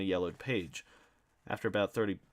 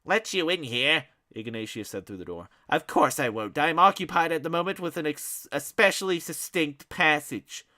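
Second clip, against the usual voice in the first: haughty Ignatius; 7 semitones higher, 9 dB louder than usual. Acted. Ignatius refusing through the door.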